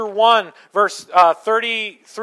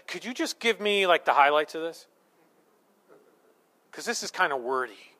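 flat, unimpressed read of the card; bored dismissive; 4 semitones lower, 5 dB quieter than usual. Retold or imitated. Wife shrugging off her birthday card, bored. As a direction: brush the card off as too wordy.

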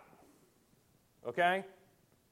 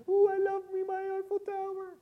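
mock lovestruck woman. Preacher mimicking a woman in love with the Eiffel Tower, high mock swoon. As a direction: gush like an infatuated woman in squeaky falsetto.

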